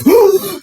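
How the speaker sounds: sharp high gasp of fright; startled gasp. Retold or imitated. Friend grabbed from behind in library, startled gasp. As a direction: gasp like she got jumped from behind. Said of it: "she, like, freaked out".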